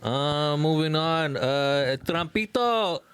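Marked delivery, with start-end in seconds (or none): none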